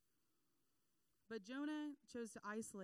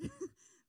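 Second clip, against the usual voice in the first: burst of laughter; 6 dB louder than usual. Real, not acted. Laughing at own story.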